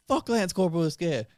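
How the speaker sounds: high, retold sergeant's rant. Sergeant dismissing a question about promotion, imitated rant. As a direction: go off like an irritated sergeant, imitating him. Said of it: "He just goes off, rants".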